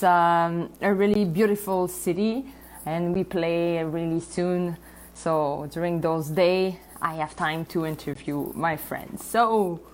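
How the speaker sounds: usual speaking voice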